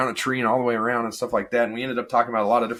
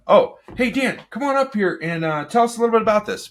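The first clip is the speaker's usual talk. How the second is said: bright, much higher welcoming host voice; host calling him up; 11 semitones higher, 4 dB louder than usual. Retold or imitated. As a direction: call him up like an eager meeting host. Mimics a host inviting him up.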